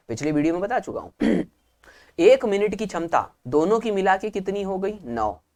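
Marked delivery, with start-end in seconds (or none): none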